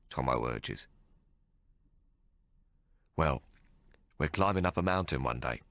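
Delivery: usual speaking voice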